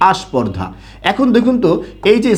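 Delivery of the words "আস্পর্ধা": আস্পর্ধা with a soft added আ at the start; Barishal pronunciation. Shown as a demonstration. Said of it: "কি বলে বলুন তো?".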